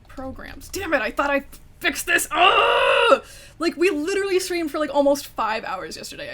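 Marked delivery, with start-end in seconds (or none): 2.3-3.2 loud high groan of frustration; frustrated shout groan. Real, not acted. Streamer groaning loudly as her stream crashes again. 3.6-6.3 exasperated rant. Real, not acted. Ranting about the crash.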